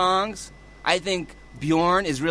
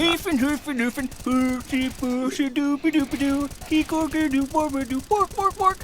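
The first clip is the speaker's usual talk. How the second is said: high gibberish Swedish Chef; 10 semitones higher than usual. Retold or imitated. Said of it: "It's a Swedish chef".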